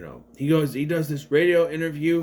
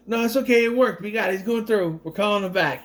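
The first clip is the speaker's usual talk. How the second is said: higher-pitched, lightly acted eager victim; mock naive insistence; 6 semitones higher, faster than usual. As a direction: imitate someone insisting, pitched up. Voices victims insisting to creepy guy.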